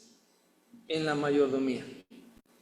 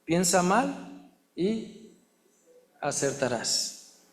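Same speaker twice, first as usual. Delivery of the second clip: slow mocking saying; slower than usual. Preacher mocking a bad proverb, drawn out slowly. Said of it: "otro dicho malo que dice".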